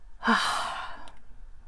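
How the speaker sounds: long, soft exhale; sigh. Real, not acted. Finishing the day's affirmation card, long sigh. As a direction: let out a long breathy sigh.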